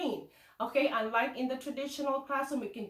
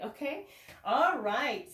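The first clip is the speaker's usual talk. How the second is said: ordinary talk, nothing marked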